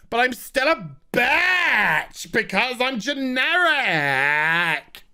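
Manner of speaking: slow, fake-crying shout; slow sobbing mock howl; mock wail. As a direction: wail it like a hammed-up teen breakdown. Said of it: "she's just like".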